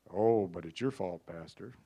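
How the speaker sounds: low, whiny blaming voice; lightly voiced grumbling churchgoer; mock complaining member. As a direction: pin it on the pastor. Mocking congregation's complaint.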